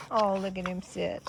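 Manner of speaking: ordinary delivery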